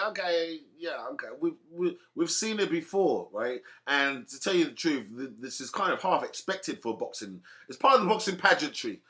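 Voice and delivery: usual speaking voice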